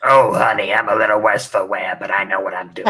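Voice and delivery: put-on voice of a worn, knowing old lady; sassy old lady toy. Worn figure to its owner, a sassy old lady voice. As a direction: purr it like a seasoned diva. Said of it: "talk to you in that voice".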